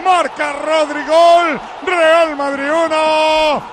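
drawn-out, shouted score announcement; live goal call. Real, not acted. Commentator announces Madrid's goal to listeners, in a drawn-out shout. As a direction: call the goal for the radio crowd.

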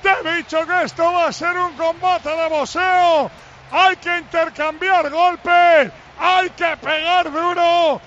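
slow, ringing, emphatic announcer's delivery; live goal commentary. Real, not acted. Commentator selling the boxing match to listeners, ringing play-by-play. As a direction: punch out short phrases, stretching the endings.

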